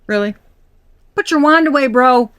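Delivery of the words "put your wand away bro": high, loud teasing rebuke; mock scolding. Real, not acted. Tarot reader teasing the card's suitor, mock scolding. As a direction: playfully tell him to back off. Scolding the Page of Wands card.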